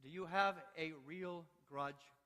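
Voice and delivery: ordinary talk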